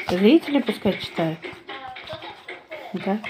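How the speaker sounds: normal talking voice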